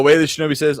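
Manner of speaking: usual speaking voice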